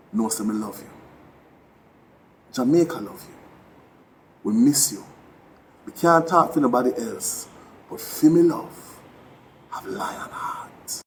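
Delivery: ordinary delivery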